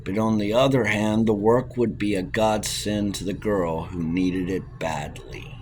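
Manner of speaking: ordinary delivery, nothing marked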